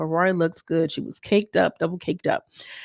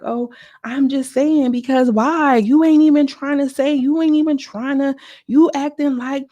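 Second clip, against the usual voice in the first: mock heated reply; 7 semitones higher, 5 dB louder than usual. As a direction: throw it back at her, voice up high. Quoted argument, high and loud.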